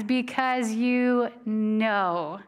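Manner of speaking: because you know, slowed right down; stress on know. Shown as a demonstration. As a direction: lean slowly on know. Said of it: "underline that word know".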